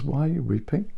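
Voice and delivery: soft, gentle asking; a quiet, caring question; tender question. Acted. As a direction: lean in kindly to a weeping woman. Jesus gently asks weeping Mary.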